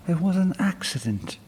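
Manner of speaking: put-on high, quick young girl; defensive excuse; girl blurting an excuse to the sprite; flustered girl. A narrator's reading. Girl pleads.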